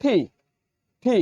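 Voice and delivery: ঠি with a breathy hard t; teacher says ঠি with aspirated t; English T. Shown as a demonstration. Teacher models the letter T for learners. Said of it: "কিন্তু এটা উচ্চারিত হবে".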